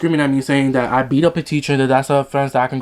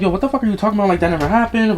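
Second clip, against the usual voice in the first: higher-pitched, mildly heated denial; retold indignant protest; 7 semitones higher, faster than usual. Student retelling reply to false report, mildly angry protest. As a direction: protest the accusation as you retell it. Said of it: "So I get mad as fuck".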